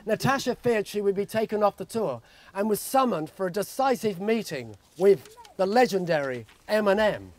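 usual speaking voice

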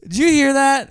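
hammed-up horror movie fright; mock spooked. Spooky ghost-movie joke.

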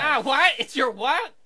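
loud, high, rising questions; appalled disbelief. Acted. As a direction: recoil in shock at what you heard. Host appalled by ghost's revelation.

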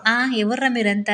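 slow, drawn-out echo of his line; mock repeat of husband. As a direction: play back his words in his tone, slowly. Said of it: "repeats husband's words". (భర్త మాటను నెమ్మదిగా అనుకరిస్తూ)

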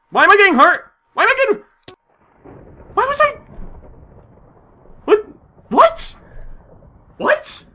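high, loud alarmed questions; panicked confusion. Real, not acted. Shrill startled what. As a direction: gamer suddenly taking damage, panicked. Suddenly hurt in game.

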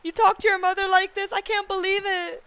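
loud, high, hammed-up mom scolding; outraged mom. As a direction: act scandalized, imitating a mom. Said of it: "she's just like".

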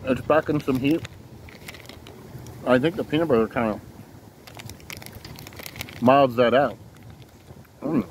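talking with food in mouth; chewing while talking. Real, not acted. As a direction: mouth partly full. Seen 3: Bites cracker at -4s; lips pressed, chewing between words through +7.8s.

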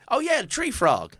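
high drawling country voice; imitating the local guy. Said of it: "He's like".

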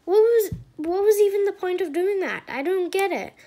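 can't believe the point of that; baffled outburst. Real, not acted. Baffled complaint about bad video.

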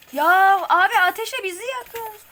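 burned alive by fire; desperate yell. Real, not acted. Burned by enemy fire, yelling. (çaresiz öfkeli haykırış)

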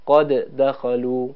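slow "قد دخلوا" with each د sounded separately; "قد دخلوا" drawn out, releasing the first د before the second; both dals pronounced. Shown as a demonstration. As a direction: spell out both dals in "قد دخلوا", slowly, unmerged. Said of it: "هر دو دال رو تلفظ کنیم".

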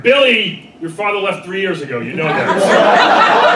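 a mother's deadpan put-down, called across the pool; mom calling out. Retold or imitated. Comedian voicing a mom answering her kid at the pool, loud call. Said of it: "heard the mom chime in".

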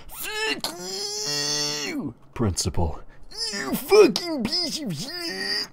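very high screaming voice. Acted. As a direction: shriek it at the principal. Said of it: "Eric is screaming".

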